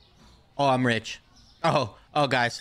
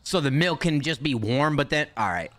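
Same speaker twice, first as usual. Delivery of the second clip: ordinary delivery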